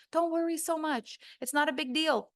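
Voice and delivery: high, dismissive advice-giver imitation; mock reassurance. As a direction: mimic someone brushing off the worry. Mimics bad advice, then rebuts it.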